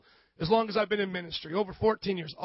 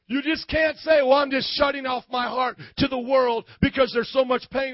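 raised, driving sermon delivery; impassioned preaching; 3 semitones higher, 4 dB louder than usual. Real, not acted. Pastor urging church to feel others' pain, impassioned preaching. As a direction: preach it hard, building to a shout.

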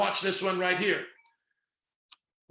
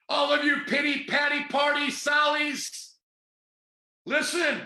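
preaching at full force; 4 semitones higher than usual. Real, not acted. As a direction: thunder it at the congregation like a Pentecostal preacher. Preacher scolding the crowd.

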